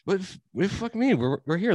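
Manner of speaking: high-pitched, stammering disbelief; acted-out baffled complaint; mock bewildered protest. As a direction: stumble over it in drunken confusion. Acts out drunken confused protest.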